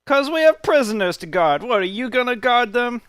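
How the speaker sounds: high, loud bickering guard voice; defiant guard. Acted. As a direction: snap back indignantly as a guard on duty. Guard argues back.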